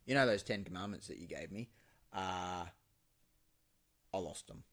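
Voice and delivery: mild, quietly guilty confession; a touch of sheepishness; sheepish Moses. Retold or imitated. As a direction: mumble it like a kid owning up. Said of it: "how do you reckon that conversation with God went".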